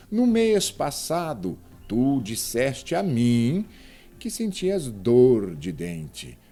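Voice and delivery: slow, emphatic model reading; deliberate reading leaning hard on "mês", "tu", "mim", "dor"; strongly stressed monosyllables. Shown as a demonstration. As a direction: punch "mês", "tu", "mim" and "dor" with full force. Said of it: "pronunciamos com bastante intensidade".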